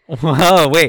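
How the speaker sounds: laughing loud whoa; surprised laugh. Real, not acted. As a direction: burst out in surprise. Catches the wrong year.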